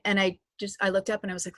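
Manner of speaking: ordinary delivery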